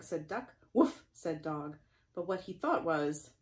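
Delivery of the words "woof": high, loud barky woof; dog woof. Acted. Dog answering the duck, loud playful woof.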